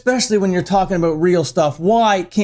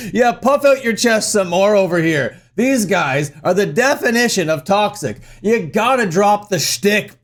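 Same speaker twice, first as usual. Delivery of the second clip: normal talking voice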